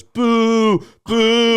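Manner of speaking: loud, high, drawn-out boo; imitated crowd boo, long and loud. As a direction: boo like a whole audience. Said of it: "Everyone boo them".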